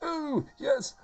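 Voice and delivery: high, swooping comic voice; silly Miranda. Shown as a demonstration. Settling on Miranda's new voice, pleased and silly. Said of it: "I like this one".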